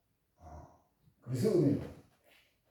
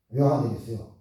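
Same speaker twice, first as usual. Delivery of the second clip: usual speaking voice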